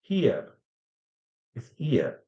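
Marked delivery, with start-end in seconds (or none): 0.0-0.5 "here" said softer, gliding between two vowels; diphthong demo. Shown as a demonstration. Teacher showing learners two vowels together, demonstrated "here". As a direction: let "here" glide through both vowels. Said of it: "when you use two vowels together".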